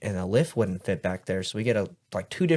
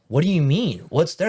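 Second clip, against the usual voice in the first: higher, louder retold confusion; acted bafflement; 7 semitones higher, 6 dB louder than usual. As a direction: demand an answer, mock-baffled. Retelling his baffled reply to coworker.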